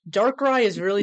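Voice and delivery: ordinary delivery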